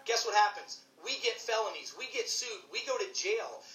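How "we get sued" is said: ordinary talk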